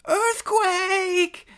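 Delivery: very high, loud yell; panicked shout. Acted. Honky alarmed as the cage swings, shouting high. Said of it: "shouted Honky".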